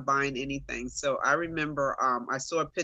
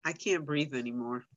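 soft, private inner voice; hushed retold thought; thinking to herself; 6 dB quieter than usual. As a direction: murmur it inwardly as a dawning realization. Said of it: "thinking to myself".

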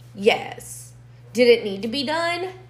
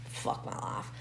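whispered aside; 15 dB quieter than usual. Real, not acted. Muttered aside.

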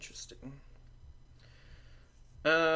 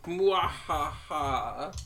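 slow hammed-up evil laugh; slow theatrical villain menace; 2 semitones higher, 4 dB louder, slower than usual. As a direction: cackle like a cartoon villain. Gloats as game turns her way.

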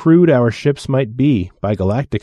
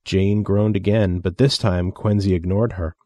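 plain reading voice

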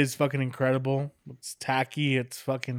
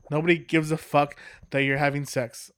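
usual speaking voice

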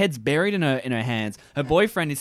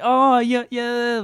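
put-on higher, drawn "oh, yeah" as the girl; girl's put-on voice; 9 semitones higher, 3 dB louder, much slower than usual. Imitating the actress in the video, mock high voice. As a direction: mimic the girl's voice, pitched well up. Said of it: "like that voice".

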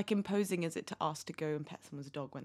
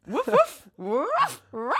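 high, loud yappy woofs; dog bark; 13 semitones higher, 9 dB louder, much slower than usual. Pretend. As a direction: bark like an excited pup. Barking at a good boy.

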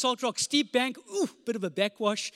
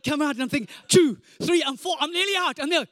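ordinary talk, nothing marked

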